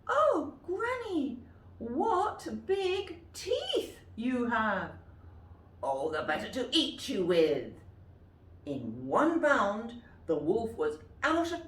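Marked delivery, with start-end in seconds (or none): 0.1-4.9 put-on very high, slow frightened girl; girl noticing the teeth; alarmed little girl. Girl questions grandmother. 5.8-7.7 louder, darker wolf; wolf about to pounce; menacing wolf. Acted. Wolf's final reply.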